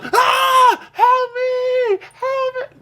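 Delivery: high-pitched hammed-up scream for help; mock yelling. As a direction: imitate a panicked woman crying out for help. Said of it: "you sitting over there yelling".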